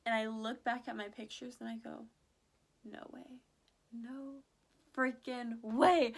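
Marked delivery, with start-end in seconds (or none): 2.8-6.1 drawn-out, stunned "no freaking way"; slow, loud, stunned disbelief; shocked disbelief. Retold or imitated. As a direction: let the disbelief build into a slow, loud punch. Disbelief at own pictures.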